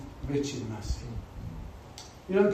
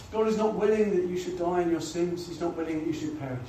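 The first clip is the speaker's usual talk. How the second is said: ordinary delivery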